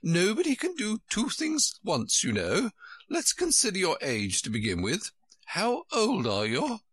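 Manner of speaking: high-pitched, dotty Queen voice; fussy high-voiced Queen. A narrator's reading.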